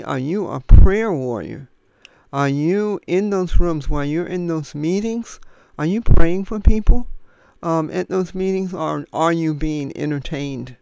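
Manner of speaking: loud, full-force pulpit appeal; fervent preaching. Acted. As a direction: plead with the congregation at full voice. Preacher raises voice to listeners.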